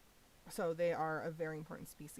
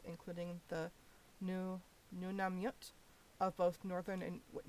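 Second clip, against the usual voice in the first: usual speaking voice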